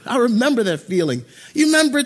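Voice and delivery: ordinary delivery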